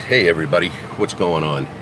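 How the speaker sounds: normal talking voice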